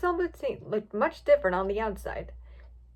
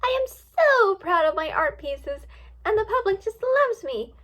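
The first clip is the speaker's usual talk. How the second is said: Rainbow Dash; 9 semitones higher, 4 dB louder than usual. A narrator's reading. Rainbow Dash on her art pieces, flamboyant gushing. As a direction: gush with theatrical self-love. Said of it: "in a very flamboyant voice".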